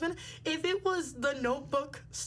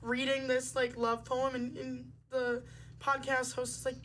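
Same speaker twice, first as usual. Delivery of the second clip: ordinary talk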